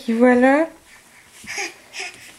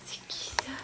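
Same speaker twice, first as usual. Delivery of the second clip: soft unvoiced whisper; 19 dB quieter, faster than usual. Real, not acted. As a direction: breathe it as a whisper. Whisper while playing with baby.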